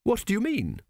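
king snapping at the priest; snapped. A narrator's reading. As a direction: cut him off sharply. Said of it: "snapped Tepic".

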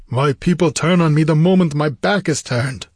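raving. A narrator's reading. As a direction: rave like a betrayed king. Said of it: "raved Conan".

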